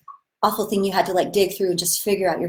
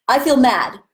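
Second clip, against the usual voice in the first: loud, raised mad declaration; louder and higher, mock mad; 4 semitones higher, 6 dB louder than usual. Shown as a demonstration. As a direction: announce that you're mad. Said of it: "So you say it how you feel it".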